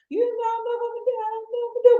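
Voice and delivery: high-pitched mock fretting; fast, high, comically unsure; uncertain. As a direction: imitate a flustered friend, way up high. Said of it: "go from that to uncertainty".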